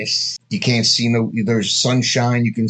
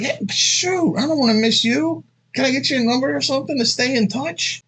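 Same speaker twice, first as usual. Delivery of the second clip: asking for her number; 11 semitones higher than usual. Retold or imitated. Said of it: "I'm like".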